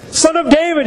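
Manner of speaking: high-pitched quoted cry. Acted. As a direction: lift the voice into a beggar's call. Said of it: "he cried".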